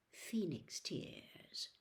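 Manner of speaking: slow, soft whisper; quiet whisper. A narrator's reading. Riddle quietly notices the healing tears, whispering. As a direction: breathe it out in wonder. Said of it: "said Riddle quietly".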